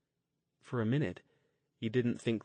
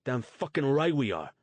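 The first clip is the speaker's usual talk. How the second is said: louder and higher, near a shout; almost yelling; 5 semitones higher, 7 dB louder than usual. Acted. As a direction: bark it back, fear turned to anger. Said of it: "Luke was almost yelling now".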